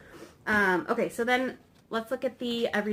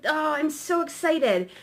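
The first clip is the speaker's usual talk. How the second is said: high-pitched, gushing excitement; anxious excitement; 6 semitones higher than usual. Acted. Card voiced as bursting with energy, excited. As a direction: gush like you can hardly wait. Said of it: "an anxious positive energy".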